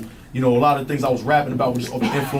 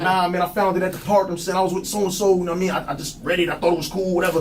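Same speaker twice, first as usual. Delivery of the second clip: put-on lying kid; 5 semitones higher than usual. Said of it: "I'm like".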